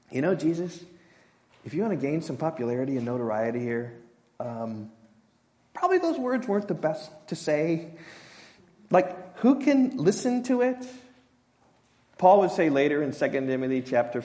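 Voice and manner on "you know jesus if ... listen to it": soft, shifting mock counsel; disciples advising Jesus. Disciples lecturing Jesus on popularity, soft mock advice. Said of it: "What are they saying?".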